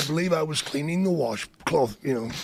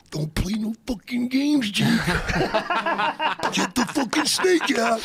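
higher, menacing wrestler voice; Macho Man; 4 semitones higher, slower than usual. Retold or imitated. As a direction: stretch the order out, wrestler-style. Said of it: "That's a great Macho Man impression".

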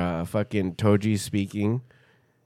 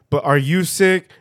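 higher, louder pushy asking; louder, higher insistent ask; insistent question; 7 semitones higher, 6 dB louder than usual. Retold or imitated. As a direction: press the question harder. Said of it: "insisting again".

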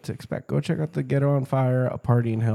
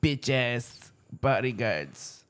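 quoting a movie character; 4 dB quieter, slower than usual. Retold or imitated. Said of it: "He's like".

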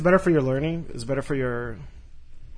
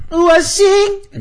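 Uashin screamed high and loud; screaming; 12 dB louder than usual. Retold or imitated. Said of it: "In the shower, like".

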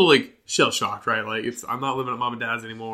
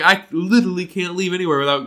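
retold exasperation; 5 semitones higher, 6 dB louder than usual. Retold exasperation at vomit everywhere.

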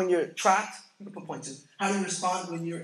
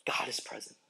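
hushed whisper, barely voiced; 7 semitones lower, 11 dB quieter than usual. Pastor whispering God's presence to the congregation. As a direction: breathe it like a secret.

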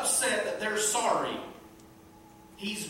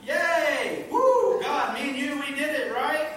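high, loud cheering as Jonah; mock celebration; 9 semitones higher, 8 dB louder than usual. Preacher voicing Jonah cheering his success, loud and high. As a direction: whoop it up like a gloating winner.

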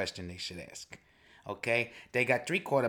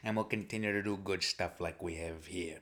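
whispered aside; 3 semitones lower, 7 dB quieter than usual. Acted. Trails into a whisper.

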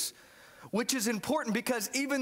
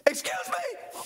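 sky-high incredulous squeal; shocked exclamation. Acted. Preacher imagines Nicodemus exclaiming at Jesus, shocked squeal. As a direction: leap way up in pitch, comically appalled. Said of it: "He exclaimed, like, I see exclaimed as he said".

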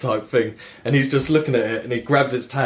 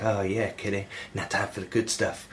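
hushed, softer private gloating; furtive captain; 2 semitones lower, 9 dB quieter than usual. Retold or imitated. Captain alone with the cat, furtively eager. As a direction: act out secret anticipation under your breath.

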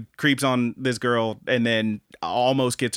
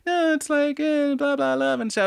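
girlfriend mimic; 14 semitones higher than usual. Imitates girlfriend dismissively.

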